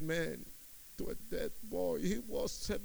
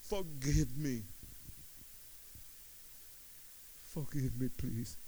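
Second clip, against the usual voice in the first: quiet plea; 3 semitones lower, 5 dB quieter than usual. Retold or imitated. Begging forgiveness after screaming.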